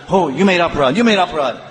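high-pitched, overwrought mock accusation; hyper accusing. Hyper devotee accusing another of offense, mock alarmed. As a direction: pounce on the fault, mock-hysterical. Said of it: "aparad hyper".

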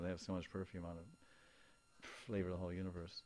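ordinary talk

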